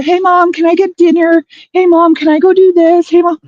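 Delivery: put-on high, pestering child; whiny kid voice. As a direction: nag like a needy kid. Voicing nagging daughter on phone.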